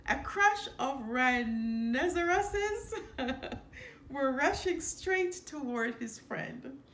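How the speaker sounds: cracking up at the tricky word; laughing through the line. A narrator's reading. Narrator fumbling rhinoceroses, laughing.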